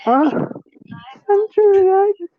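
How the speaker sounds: put-on high, breathy new-girl act; girly voice. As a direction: play a shy lost girl.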